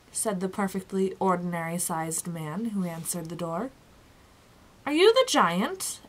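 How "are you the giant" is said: questioning, higher-pitched doubt; unsure, raised-pitch question; doubtful. A narrator's reading. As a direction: ask it with doubt, voice lifted. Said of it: "asked Tock doubtfully".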